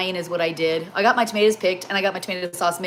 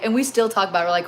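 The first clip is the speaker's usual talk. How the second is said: ordinary delivery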